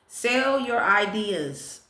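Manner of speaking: slow, deliberate read-out; measured announcement; fortune proclamation. Real, not acted. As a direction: give each word of the fortune room. Repeats fortune for viewers.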